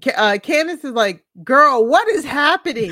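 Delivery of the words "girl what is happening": loud, higher, incredulous outburst; mock bewilderment. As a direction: demand answers like a baffled friend, half laughing. Acting out baffled friend's outburst.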